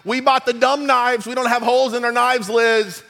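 retelling his own fed-up outburst at the store-bought knives; exasperated. Retold or imitated. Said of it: "And I'm like".

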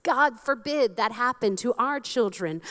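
ordinary delivery

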